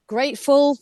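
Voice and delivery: ordinary talk, nothing marked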